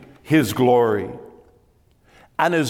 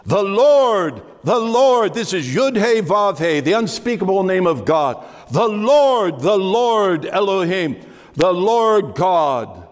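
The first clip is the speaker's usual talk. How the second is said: each Lord raised and hit hard, falling; emphasis on the Lord; 5 semitones higher than usual. Shown as a demonstration. Preacher reading Exodus to congregation, each Lord stressed. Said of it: "there's an emphasis".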